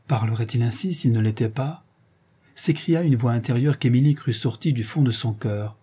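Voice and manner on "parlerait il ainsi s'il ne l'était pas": ordinary delivery, nothing marked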